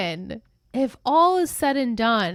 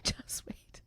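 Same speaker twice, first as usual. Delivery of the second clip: whisper; 16 dB quieter than usual. Acted. Teasing about what comes next.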